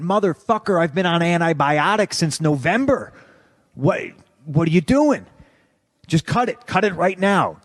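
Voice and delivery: high-pitched indignant protest; worked-up demand, acted out; exasperated retort. Retold heated outburst at doctor.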